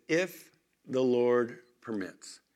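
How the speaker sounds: slow and deliberate, weighing each word; preacher slowly quoting Paul; slow emphatic quote. Acted. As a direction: lean on the apostle's condition. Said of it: "but he says".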